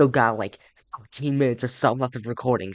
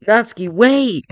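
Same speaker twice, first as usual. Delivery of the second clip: high, loud, drawn-out shout; urgent calling out; 9 semitones higher, 8 dB louder, slower than usual. Acted. Main character calls after fleeing Natsuki, urgent shout. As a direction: call after someone running away.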